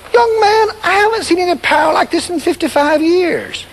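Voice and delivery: put-on an old preacher marveling; lightly voiced old missionary.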